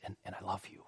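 barely voiced, very soft affection; tender father. Dad to his newborn as she calms, tender. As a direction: drop right down, gentle and loving. Dad tells baby he loves her.

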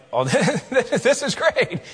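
high, slow, laughing through the words; laughing friend. Retold or imitated. Church friend stepping into trailer, laughing slowly. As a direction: chuckle while saying it. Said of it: "He laughed all the time".

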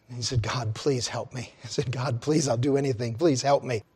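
soft, low pleading; hushed desperate pleading. Acted. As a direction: beg God quietly. Man pleads quietly with God.

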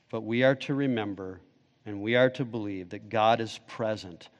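low, soft, sober sermon voice; quiet, low-pitched pastoral appeal; hushed preaching. Real, not acted. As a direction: bring it right down, grave and measured.

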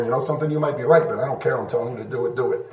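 low, soft, tired insistence; dismissive parent. Acted. Parent refusing to explain to a child, dismissive and low. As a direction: brush the child off quietly and low. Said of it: "it's kinda code for".

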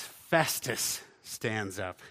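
normal talking voice

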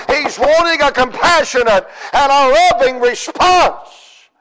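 driving, rhythmic pulpit delivery; full-force preaching. Real, not acted. Preacher showing Jairus pleading at Jesus' feet, driving intense preaching. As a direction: pile up the phrases and build steadily.

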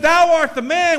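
loud, high prophetic accusation; accusing proclamation. Retold or imitated. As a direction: point the finger and declare it. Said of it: "preacher ... stuck his finger in his face".

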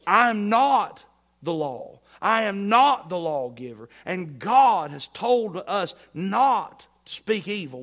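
loud, slow, emphatic declaration; preaching at full force. Real, not acted. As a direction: thunder each word down. Preacher rising to climax.

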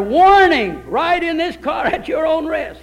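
loud announcement of the sign; mock warning sign. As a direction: boom it out like a warning notice. Reads the joke car sign aloud.

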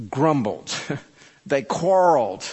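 ordinary talk, nothing marked

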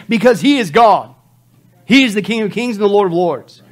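loud, high pulpit proclamation; full-force preaching. Real, not acted. Preacher proclaims Christ as God to congregation, at full force. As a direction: proclaim it at the climax.

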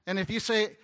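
ordinary talk, nothing marked

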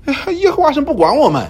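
high, whiny complaint; grumbling Israelites. Retold or imitated. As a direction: moan that God has abandoned you. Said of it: "以色列人怎么说". (高声抱怨的以色列人)

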